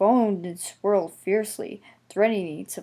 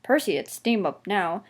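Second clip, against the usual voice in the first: plain reading voice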